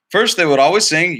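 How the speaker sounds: usual speaking voice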